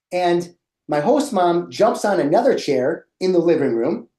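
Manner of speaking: ordinary delivery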